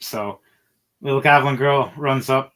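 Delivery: ordinary delivery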